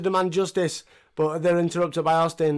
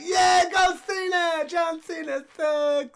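mock fan cheer; 13 semitones higher, much slower than usual. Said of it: "You're like".

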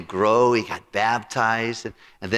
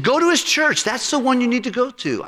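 much higher, louder insistence; insistent friend's voice; 6 dB louder than usual. Retold or imitated. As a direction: push him firmly toward the church, pitched way up. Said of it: "He said".

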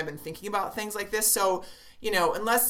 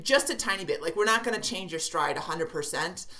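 ordinary delivery

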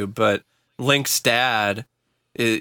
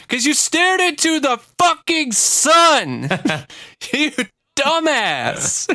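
mocking yell; 12 semitones higher, 3 dB louder than usual. Mocking gullible believers, laughing.

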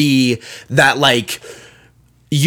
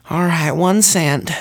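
soft, slow resigned sigh into "all right"; defeated sigh; 5 dB quieter, slower than usual. Retold or imitated. Baker finally giving in, soft defeated sigh. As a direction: give up with a long sigh. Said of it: "finally she was like".

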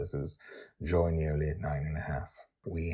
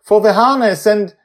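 put-on child shouting down; 15 dB louder than usual. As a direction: shout down proudly from the deck. Joy lists plan excitedly.

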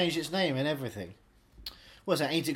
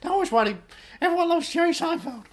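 mock whine; 13 semitones higher, 7 dB louder than usual. Mock whiny rant, loud.

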